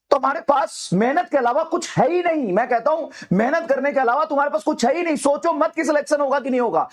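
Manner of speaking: high-pitched, relentless motivational urging; fired-up pep talk, pitched high; impassioned exhortation. Real, not acted. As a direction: drive students on with rising fervour. Rousing climax of motivational speech.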